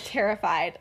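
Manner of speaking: ordinary talk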